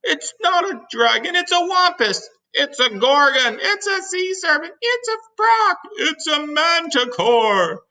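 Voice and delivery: high-pitched panicky cries; panicked shouting. Acted. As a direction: shout wild guesses in terror. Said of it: "all started shouting at once".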